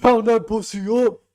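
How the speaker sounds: hammed-up quote shout; loud mock quote. Repeating the clip line.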